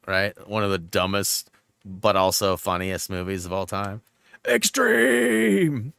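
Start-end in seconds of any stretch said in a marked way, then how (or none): 4.5-5.9 a high, far-off movie-line holler; movie quote shout. Acted. Streamer quoting Harold and Kumar, hammed-up holler. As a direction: belt it like the film character.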